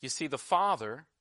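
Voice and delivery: usual speaking voice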